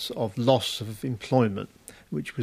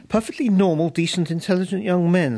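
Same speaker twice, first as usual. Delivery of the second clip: higher and louder, temper held in check; barely controlled temper; 8 semitones higher, 3 dB louder than usual. Acted. Robin defends her male students, barely controlling her temper. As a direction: hold back rising anger. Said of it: "struggling to control her temper".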